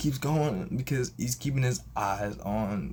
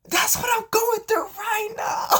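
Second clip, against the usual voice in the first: very high, loud retold cry; mock wailing outburst; 7 dB louder than usual. Coworker opening up to the caller, mock high wail. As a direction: exclaim it way up high, hammed-up.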